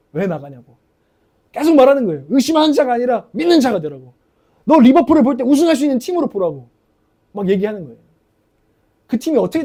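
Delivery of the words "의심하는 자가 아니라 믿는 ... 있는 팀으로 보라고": loud, forceful imitated rebuke; retold fiery anger. Coach angry at fans for walking out, loud retold rebuke. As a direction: drive it home like an angry coach. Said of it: "막 불같이 막 화를 내요".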